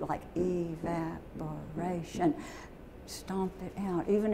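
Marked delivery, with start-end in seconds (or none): 0.4-2.3 slow, soft, broken into syllables. Shown as a demonstration. Teacher showing how to stamp out syllables, slow and soft. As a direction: sound out the word slowly, a beat per syllable. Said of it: "or put it on your feet".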